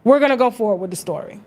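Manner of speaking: usual speaking voice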